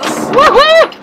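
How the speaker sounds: high whoop; cheering. Real, not acted. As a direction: let out a happy whoop. Cheering on the bumps.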